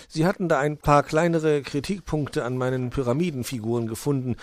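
chatty kobold. Acted. Wuschel's voice in read story.

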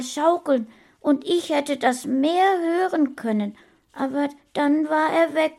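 put-on high, loud plaintive child voice; sad little boy. Boy remembering his lost papa, plaintive. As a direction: tell it like a hurt small child.

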